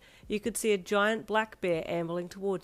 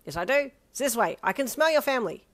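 put-on loud, offhand boy voice; nonchalant brash boy; 6 semitones higher, 7 dB louder than usual. A narrator's reading. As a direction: say it like it's obvious. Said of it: "he said nonchalantly".

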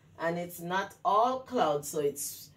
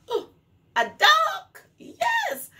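much higher, louder delighted cry; excited shout; 5 dB louder, slower than usual. Acted. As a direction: shout out the answer like a big surprise. Excited reveal to children.

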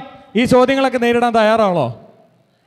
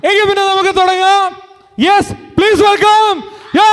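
high, stretched-out welcome call; stage announcer; 8 semitones higher than usual. Real, not acted. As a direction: announce the guest with a big build. Anchor announcing guest on stage. (വേദിയിലേക്ക് അതിഥിയെ സ്വാഗതം ചെയ്യുന്ന announcer ശൈലി)

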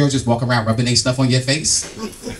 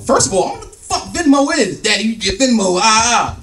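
raised, lightly put-on daughter voice; a hint of a higher-pitched girl talking back; sassy daughter; 5 semitones higher than usual. Daughter schooling dad on Venmo, mildly sassy voice.

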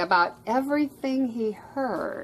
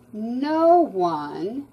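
ordinary delivery

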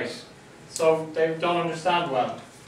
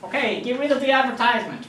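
lightly voiced US boss giving up; a hint of a dismissive American client; retold client, higher, brushing it off; mock US client; 5 semitones higher than usual. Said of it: "the U S guys say".

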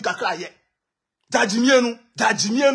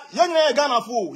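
ordinary talk, nothing marked

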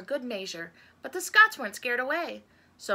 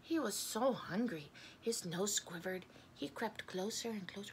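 soft, hushed storytelling; quietly building suspense; 10 dB quieter than usual. A narrator's reading. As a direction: drop the volume as if creeping closer. Narrator builds suspense before a warning.

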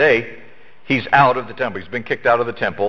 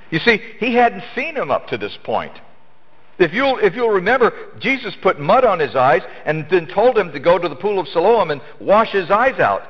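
higher-pitched, animated sermon retelling; impassioned preaching; 7 semitones higher than usual. Real, not acted. Preacher recounting the blind man's healing to congregation, impassioned. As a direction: drive the story upward to a high-pitched peak.

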